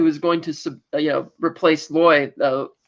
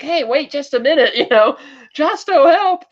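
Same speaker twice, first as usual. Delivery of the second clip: high mock scream with a laugh in it; retold screaming; 8 semitones higher, 3 dB louder than usual. Retelling Areta calling to Josto for help, mock screaming. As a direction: cry out high, imitating a panicked plea. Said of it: "even she's screaming".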